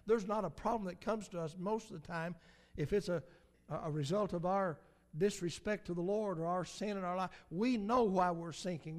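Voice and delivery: ordinary delivery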